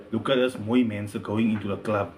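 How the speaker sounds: ordinary delivery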